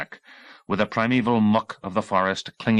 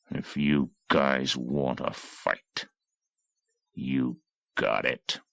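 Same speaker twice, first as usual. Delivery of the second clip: low, slow hateful growl; hateful threat; 7 semitones lower, 5 dB quieter, much slower than usual. Acted. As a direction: spit it out slowly, full of hate. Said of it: "squinting with hate".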